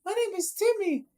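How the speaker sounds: put-on very high child voice; little kid. Little kid telling a stranger his name, high put-on voice. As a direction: answer in a tiny, high kid's pitch. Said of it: "go to a little kid like".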